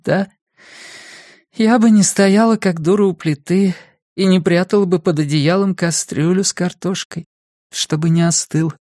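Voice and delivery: lightly voiced mother holding back tears; hurt mother. Mother complaining to her son that she waited by the stove, hurt.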